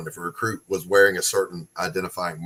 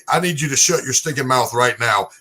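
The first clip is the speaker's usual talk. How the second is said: louder barked drill sergeant order; 3 semitones higher, 6 dB louder than usual. Retold or imitated. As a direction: bark it at a recruit like a drill sergeant. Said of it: "That is the sergeant".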